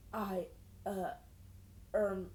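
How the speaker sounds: halting, broken by pauses; stammer, lost for words; stuttering. A narrator's reading. Boy flustered by her outfit, stuttering. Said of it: "he stuttered".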